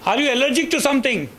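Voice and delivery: high, loud and fast nurse question; a busy nurse asks briskly. Retold or imitated.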